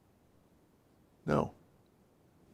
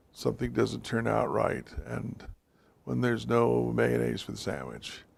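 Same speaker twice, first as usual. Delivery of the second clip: ordinary talk, nothing marked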